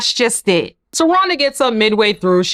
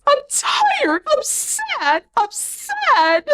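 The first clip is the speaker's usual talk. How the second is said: mock whiny wife, much higher and rising to a squeak; whiny Rhonda; 12 semitones higher than usual. Vlogger mocks the wife crying in the bathroom, high whiny. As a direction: whine it out in a high, pitiful voice. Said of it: "Rhonda's like".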